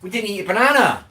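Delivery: high exclamation with falling ends; dismayed realisation. Real, not acted. Streamer realising the banana was forgotten, high dismayed exclamation. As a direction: cry out at a sudden oversight.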